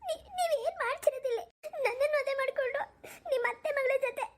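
high-pitched, tearful reproach. Acted. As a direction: choke out the hurt accusation. Wife upset, finds husband with cousin.

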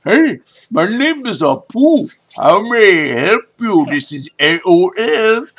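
playful Indian accent impression of a support agent; imitating Apu. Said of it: "And he talked like this".

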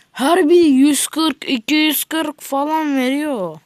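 thrilled exclaim; excited. Real, not acted. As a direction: marvel at the money. Excited over money drop.

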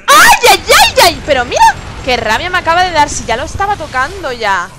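high, very loud yelp easing into a complaint; grito de rabia. Real, not acted. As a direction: shriek out in frustration at the game. Hit in game, real outburst. (chillido de rabia al recibir el golpe)

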